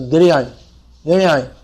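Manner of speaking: Giriyai slurred short, without the dh; mispronounced name. Shown as a demonstration. Teacher illustrating careless naming, Giriyai without its middle consonant. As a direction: run the name together as Giriyai. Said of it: "actually it's not Giriyai".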